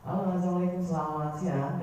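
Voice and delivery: friendly smiling phone opener; smiling greeting. Shown as a demonstration. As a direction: greet the caller with a smile in the voice. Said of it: "pembawaannya memang harus kondisikan tersenyum".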